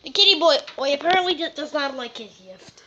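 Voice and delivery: solemn wildlife narrator voice; nature documentary. Acted. Kid narrates cats as documentary.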